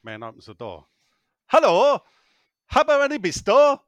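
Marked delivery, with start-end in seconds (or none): 1.5-3.8 loud, high Yorkshire holler; hammed-up shouted request, pitched right up; calling out. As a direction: bellow it at a stranger's door in mock Yorkshire. Husband calls at a neighbour's door.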